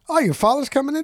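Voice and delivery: high, rising mock surprise at the news; surprised echo. Teller echoing the golf-ball man, surprised. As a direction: act startled that his father is coming in.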